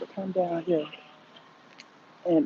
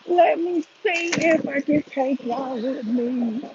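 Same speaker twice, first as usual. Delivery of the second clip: slow, higher, drawn-out singsong; slow sing-song; 8 semitones higher, slower than usual. Real, not acted. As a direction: stretch every word out playfully. Repeats phrase, second drawn out.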